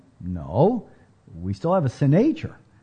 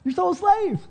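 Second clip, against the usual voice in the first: high, rising preacher's exhortation; preaching at full force; faster than usual. Acted. Preacher urging his church, rising in passion. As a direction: drive the point home, climbing.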